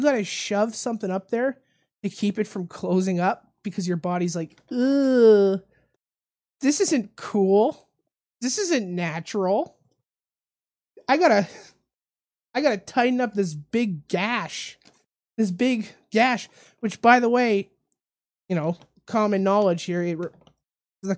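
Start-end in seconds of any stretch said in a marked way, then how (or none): 4.7-16.5 disgusted body. Pretend. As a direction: voice a body grunting in mock disgust. Imagined body voicing its disgust.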